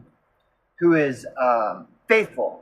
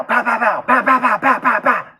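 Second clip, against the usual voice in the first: higher, rapid mock gunshots; gunshot imitation; 7 semitones higher than usual. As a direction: spit out each pow like a shot. Mimicking a burst of gunshots.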